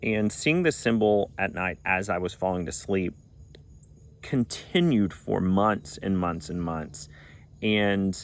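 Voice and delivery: normal talking voice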